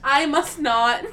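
slow, prim mock refusal; mock-formal refusal. Mock-prim refusal to a friend's dare, slow. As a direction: drag it out like a stubborn duchess.

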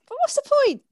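high mock exclamation; high pitched. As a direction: squeal it up high. Exaggerated joke line.

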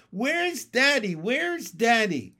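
high, loud, stretched-out wail; childish scream. A narrator's reading. Froglets bunched round their mother, screaming for their father. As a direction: scream slowly for a missing parent. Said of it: "screamed the froglets".

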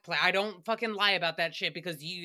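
ordinary talk, nothing marked

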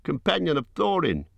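high, slowed call naming himself; crying out. A narrator's reading. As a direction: call out so the stranger can find him. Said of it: "he cried".

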